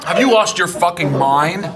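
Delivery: loud, indignant ranting; mock outraged rant. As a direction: blow up in comic outrage.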